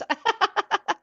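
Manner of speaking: short high burst of laughter. Real, not acted. Podcaster laughing at herself over suppressed tears, laughing out loud. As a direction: let a bright laugh escape.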